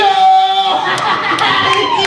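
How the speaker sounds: high loud burst of laughter; real loud laugh, pitched high. Real, not acted. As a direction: laugh at your own song. Laugh after singing.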